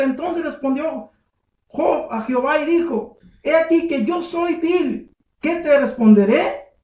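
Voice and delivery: high, loud preaching, slowing on the verse; preaching scripture. Acted. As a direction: preach it out to the congregation. Preacher resumes and reads scripture. (predicación fuerte, lenta en el versículo)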